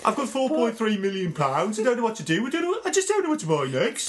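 a whiny Brummie sigh over the winnings; mock Brummie. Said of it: "It's exactly like that".

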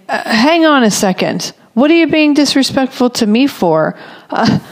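mother jumping on her snappy son, hammed-up indignation; mock indignant scolding. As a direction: replay the snap-back you'd normally give, indignant but light. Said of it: "Instead of jumping on him like that".

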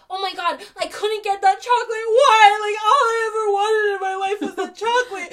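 put-on high, loud, sobbing kid despair; crying child wail. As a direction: wail like life ended over chocolate. Imitating a child over chocolate.

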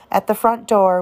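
plain reading voice

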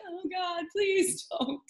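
embarrassed plea. Retold or imitated. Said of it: "And I'm like".

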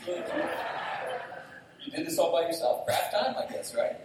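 loud, laughing mockery; teasing laugh. Young man teasing pastor about craft time, laughing loudly.

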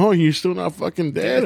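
snarky comeback. Retold or imitated. Replying to the aunt, slow snarky sweetness. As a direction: sweetly deliver the insult. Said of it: "I'm like".

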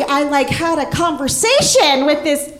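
ordinary talk, nothing marked

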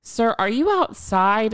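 high, slow official question; mock dispatcher. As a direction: ask it like a careful dispatcher. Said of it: "And she was like".

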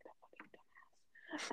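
slow, whispered insult; under the breath. Retold or imitated. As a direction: imitate someone cursing under her breath. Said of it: "she under her breath".

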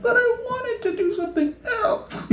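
very high, tearful whine; mock wail. Inner voice of someone unsatisfied, mock crying. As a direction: whimper it like a thwarted child, hammed up.

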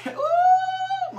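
ooh flung up high, then Jesus; a spirit-filled cry soaring up; high ecstatic ooh. Real, not acted. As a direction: falsetto praise cry. Preacher overcome mid-sermon.

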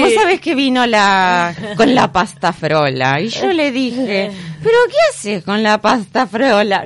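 put-on slow old lady character voice; lightly voiced chatty grandma; Mamá Cora voice. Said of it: "le imitaba".

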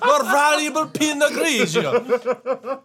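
put-on high, loud, drawn-out French accent; hammed-up French accent, stretched out slowly; mock French accent. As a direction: ridicule with an exaggerated French accent. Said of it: "Is that some French thing?".

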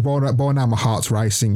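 plain reading voice

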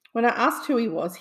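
plain reading voice

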